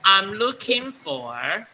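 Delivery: slow, even model reading; slow read-along. Shown as a demonstration. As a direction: lead the class word by word. (慢速领读)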